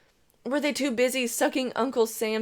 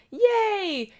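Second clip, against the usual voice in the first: put-on high, bright toddler cheer, much higher; child's excited shout; 12 semitones higher, 3 dB louder than usual. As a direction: squeal it like a happy three-year-old. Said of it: "He shouts".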